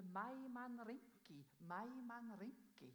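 slow, drawn-out mourners' cry; missionary imitating mourners calling out in Quechua, slow drawn-out cry; called out in Quechua. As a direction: call it out slowly like a funeral procession. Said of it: "ropte de".

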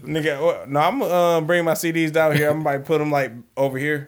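calling out loudly to someone away; yelling across the room. Real, not acted. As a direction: raise the voice to carry across the room. Answers about CDs, calling out.

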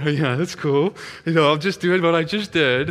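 mock-casual bravado; acting cool. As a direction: play it cool with nervous showiness. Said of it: "I'm acting all cool and all".

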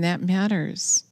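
normal talking voice

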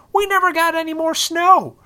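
high, loud exasperated outburst; exasperated rant. Real, not acted. Podcaster ranting about the missing winter snow, high loud exasperation.

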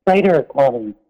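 normal talking voice

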